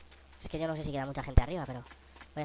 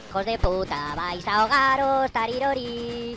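gloating taunt; 6 semitones higher, 6 dB louder, slower than usual. Pretend.

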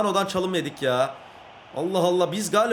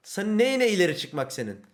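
ordinary delivery

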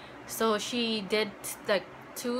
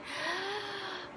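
gasp; 11 semitones higher, 9 dB quieter than usual. Retold or imitated. Recalling answer to worried uncle.

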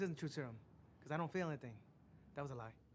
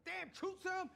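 very high exasperated cry; dismayed; slower than usual. Real, not acted. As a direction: wail at the drug in your blood. Cursing the truth serum.

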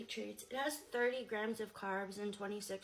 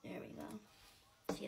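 whispering; 8 dB quieter than usual. Real, not acted. Quietly watching candy come out.

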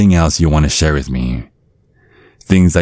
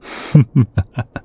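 higher, gleeful evil chuckle; evil laugh; 9 semitones higher than usual. Acted. Dad gloating over the next victim, evil chuckle. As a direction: laugh like a villain who just won.

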